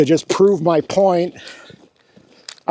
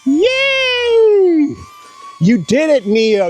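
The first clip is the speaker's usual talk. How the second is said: excited cheer; 12 semitones higher, much slower than usual. Real, not acted. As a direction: cheer the drone on. Drone tracked him, celebrating.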